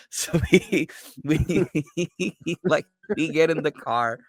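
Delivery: high voice breaking into real laughter; cracking up mid-story; laughing while talking. Real, not acted. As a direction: push the words out through laughter. Teller cracks up at own story.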